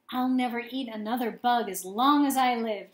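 plain reading voice

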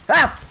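sudden high startled cry; startled yelp. Real, not acted. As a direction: jump at the fright with a short yelp. Jump scare in horror game.

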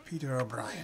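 a hammy, put-on Irish accent on the name; poor Irish accent. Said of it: "that's a poor Irish accent".

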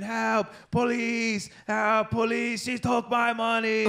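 high, slow, hammed-up mock plea for police; mock cry for help. Imitating a neighbor screaming for police on the street, high mock wail. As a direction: wail it like a man crying for help. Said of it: "he starts screaming".

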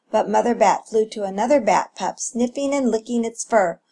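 ordinary delivery, nothing marked

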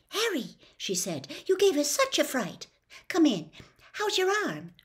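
put-on high, startled girl's voice; Hermione. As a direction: schoolgirl startled, pitched high.

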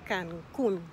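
Cancun loud, landing on COON; emphatic model "Cancun", U stressed. Shown as a demonstration. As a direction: hit the final syllable of Cancun. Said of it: "with the emphasis on the U".

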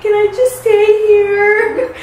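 high, drawn-out tearful plea; mock pleading. Runaway begging friend's dad to let her stay, mock tearful plea. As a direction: imitate a kid begging, hammed up.

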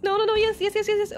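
high, nervous rapid repetitions; nervous outburst. Real, not acted. Player reacting in a tense game moment, nervous and high. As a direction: rattle it off in high-pitched nerves. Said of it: "I'm getting really nervous".

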